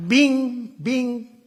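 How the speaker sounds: high, ringing mock chime; imitated landing chime. President imitating the cockpit chime at touchdown. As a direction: sing out the touchdown bing.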